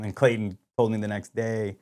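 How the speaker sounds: ordinary talk, nothing marked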